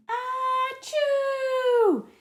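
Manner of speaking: very high, loud explosive achoo; huge sneeze. Acted. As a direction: blast the sneeze out. Clifford sneezes down house.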